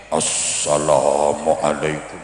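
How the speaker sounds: deep, slow, inflated orator's greeting; big preacher voice. Retold or imitated. Preacher opening a pidato, voice made big. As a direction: puff the voice up big and low, drawn out. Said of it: "Biasanya suaranya digedek-gedek".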